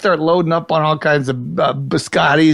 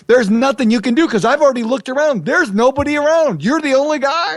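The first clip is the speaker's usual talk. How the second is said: retold high exasperated rant; 9 semitones higher than usual.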